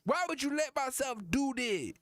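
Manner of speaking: high-pitched mock outrage; comic angry rapper impression. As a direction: berate your wife in a hammed-up high voice. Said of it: "Then he gets mad at [name]".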